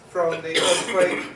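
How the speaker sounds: ordinary talk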